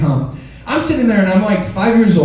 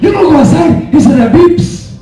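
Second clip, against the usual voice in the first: higher, louder Mexican accent, hammed-up panic; telenovela shout; 9 semitones higher, 3 dB louder than usual. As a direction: shout the warning like a soap opera dad in a Mexican accent. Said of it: "Like a telenovela".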